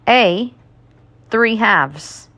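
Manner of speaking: usual speaking voice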